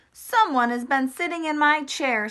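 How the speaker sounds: lightly voiced papa bear. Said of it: "said Papa Bear".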